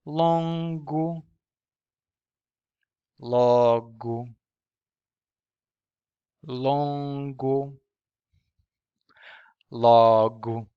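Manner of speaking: nasal "longo" against oral "logo"; longo versus logo. Shown as a demonstration. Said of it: "But these are".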